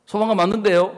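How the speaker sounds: husband insisting loudly he is right; loud protest. Retold or imitated. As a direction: push back hard, offended.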